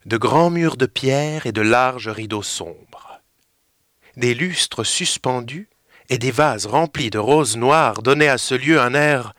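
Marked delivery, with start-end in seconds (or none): none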